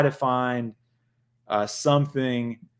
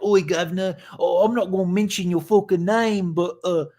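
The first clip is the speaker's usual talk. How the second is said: mock British accent; 5 semitones higher, 3 dB louder than usual. Host mocks a rival YouTuber in a mock British accent. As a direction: send up the Brit with a put-on British accent. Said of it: "you old Brit".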